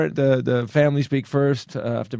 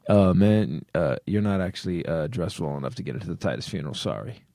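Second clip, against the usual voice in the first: low bouncer voice; 7 semitones lower, 4 dB quieter than usual. Retold or imitated. Bouncer at velvet rope.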